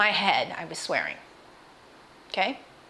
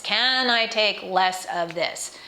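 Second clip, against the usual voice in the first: slow careful question; much slower than usual. Retold or imitated. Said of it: "I asked a question".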